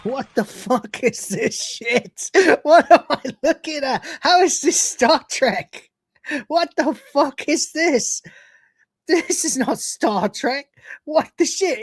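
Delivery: exasperated. Real, not acted. Exasperated at trailer.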